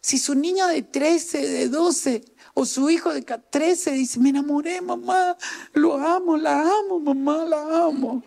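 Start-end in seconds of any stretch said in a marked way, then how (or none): 4.2-8.2 put-on lovestruck teen daughter. Teen daughter announcing she's in love.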